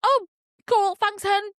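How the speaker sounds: high, loud sarcastic gratitude; mock thanks. Passenger replying to railway worker, sarcastic thanks. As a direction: thank them with exaggerated high-pitched cheer.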